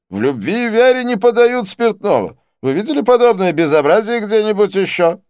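high, loud outrage; hammed-up indignation, pitched high; indignant complaint. A narrator's reading. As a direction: protest loudly at a scandal. Didier complains about the bar.

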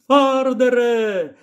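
put-on high, drawn-out girl's call. Lyra hails the old man from the basket, high drawn-out call. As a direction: call his name across the field. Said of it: "гукнула Ліра".

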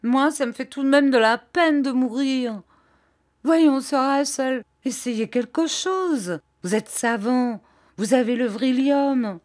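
put-on whimpering like a scared little boy; childlike whine. A narrator's reading. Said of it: "pleurarde comme celle d'un enfant".

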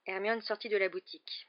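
ordinary delivery, nothing marked

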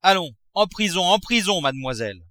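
a raging miser banishes his daughter; angry father. A narrator's reading. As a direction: order her off in a rage.

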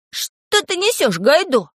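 put-on high-pitched, incredulous boyish outburst; exasperated high protest; interrupting. A narrator's reading. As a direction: cut in, voice jumping up. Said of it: "прервал его Пашка".

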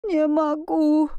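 high, drawn-out squeak of fear. A narrator's reading. Tutor who can't back away from the beast, squeaking. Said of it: "пропищал гувернёр".

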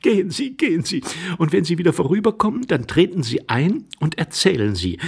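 high, quick feminine urging; lively calling lady. Acted. Lady sending the intruder off, lively and eager. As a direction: send him off briskly, starting high. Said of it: "rief die Dame lebhaft".

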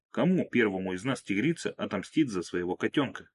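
ordinary delivery

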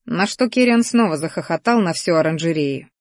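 plain reading voice

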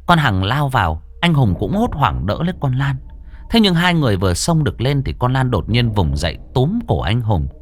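steady storyteller reading a ghost tale; story narration. A narrator's reading. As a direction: tell it like a spooky radio story. Reads horror story narration. (giọng kể chuyện ma)

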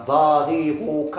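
very slow, drawn-out ضاربك; slow model word. Shown as a demonstration. Teacher dictates the correct form to students, very slowly. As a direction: stretch ضاربك out for the class. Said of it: "ما تقولش ضارب".

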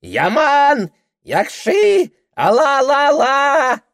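put-on high, loud drunken bleating yell. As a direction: holler like a goat on a fence. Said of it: "орёт, как козёл на заборе".

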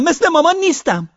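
loud high repeat stressing مثل; girl screaming. Acted. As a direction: scream again, punching مثل. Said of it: "لیزا فریاد کشید". (فریاد دوباره با تأکید روی مثل)

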